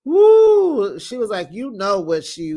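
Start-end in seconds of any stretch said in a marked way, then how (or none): 0.0-1.0 loud, high whoop; whooping cheer. Real, not acted. Host cheering at the screen, loud high whoop. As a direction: let out a delighted shriek. Reacting to the confessional.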